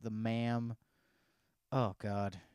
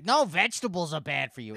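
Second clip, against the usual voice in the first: loud, higher mock contradiction; imitating stubborn ma'am; 4 semitones higher, 7 dB louder than usual. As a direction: push back stubbornly as the ma'am. Imitates ma'am arguing about diet.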